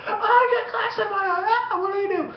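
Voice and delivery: very high mock-panicked squeal; panicking girlfriend. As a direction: imitate her panic in a hammed-up falsetto. Imitates panicking hurt girlfriend.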